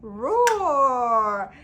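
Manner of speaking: loud dinosaur roar; just roar like a dinosaur; a plain loud roar; normal roar. Shown as a demonstration. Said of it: "just normally".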